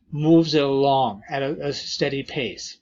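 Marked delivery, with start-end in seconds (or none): none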